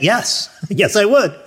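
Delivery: retold delighted yes; eager acceptance. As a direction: jump at the offer, amused at yourself. Jumps at offer of show tickets.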